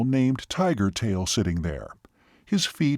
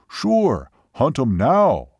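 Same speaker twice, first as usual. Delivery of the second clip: imitated Seminole man; 2 semitones higher, 4 dB louder, much slower than usual. Seminole assures waitress the hunt goes on, emphatic. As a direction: answer in short, forceful broken English. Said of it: "even imitating his voice and gestures".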